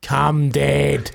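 drawn-out bossy summons, imitated; bossy child. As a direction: lean on it like an impatient kid. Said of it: "just really bossing me around".

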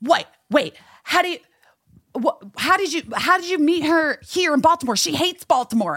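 high, stammering mock disbelief; imitated screaming fan. Fan asking how she met Heather, high excited imitation. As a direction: restart the question in a high, flustered squeal. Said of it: "but screaming, you know".

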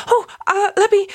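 high, eager little creature voice; eager kobold voice. Acted. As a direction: pipe it up like a tiny kobold. Player voices kobold character.

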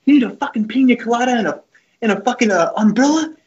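high mock coach rant climbing to a squeal; hammed-up angry coach impression; Coach Garrido. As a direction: imitate a sarcastic coach chewing out a player, pitch rising steeply. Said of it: "He goes".

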